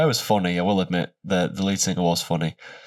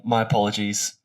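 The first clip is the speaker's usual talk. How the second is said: normal talking voice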